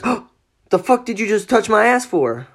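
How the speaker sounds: high-pitched indignant gasp and question; sharp, high mock outrage; startled outburst. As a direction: act out a friend startled by a grope. Friend retold as startled by touch.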